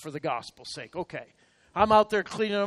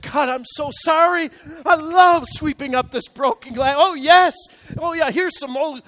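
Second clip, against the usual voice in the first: loud, high sing-song mock gladness; hammed-up eager servant voice; mock cheerful; 9 semitones higher, 12 dB louder than usual. Pastor mocking his own forced joy at sweeping.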